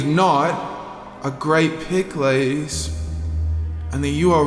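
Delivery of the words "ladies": ordinary talk, nothing marked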